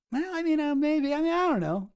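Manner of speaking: hesitant high voice, mock self-doubt; high-pitched musing. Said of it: "And then I was like".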